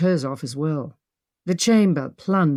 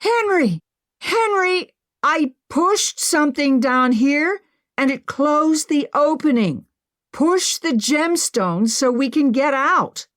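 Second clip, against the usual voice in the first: calling up; 9 semitones higher than usual. Acted. Calling up through sealed slab.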